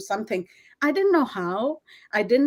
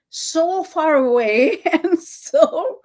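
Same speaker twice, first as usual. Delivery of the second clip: slow laughing; 6 semitones higher, 6 dB louder, much slower than usual. Real, not acted. Laughs remembering feeling lost.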